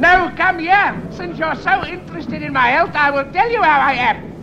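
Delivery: high-pitched indignant outburst; worked up. Acted. Gout sufferer rounding on a well-wisher, worked up. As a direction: summon them crossly, voice pitched right up. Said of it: "Don't get so worked up like that".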